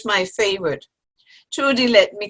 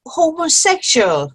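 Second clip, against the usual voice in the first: normal talking voice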